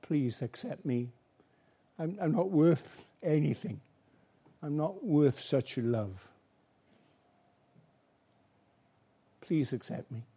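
soft, low pleading prayer; humble prayer. Pretend. A sinner asking Jesus to accept him, softly pleading. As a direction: pray it humbly, quietly, as if unworthy.

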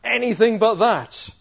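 slow, very loud cry of refusal; loud, high, desperate protest; anguished scream. Acted. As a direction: cry out in horror, drawing out each word. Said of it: "everything inside of Jesus screams out saying".

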